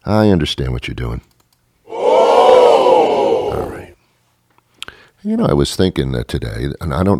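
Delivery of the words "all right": mock exasperated shout. Mock frustration after no answer.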